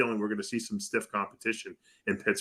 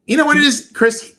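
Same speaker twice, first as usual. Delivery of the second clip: high and loud, bursting in to make the point; excited outburst; 12 dB louder than usual. Real, not acted. Radio guest cutting in on Chris, excited and loud. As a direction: blurt it out, much higher and louder.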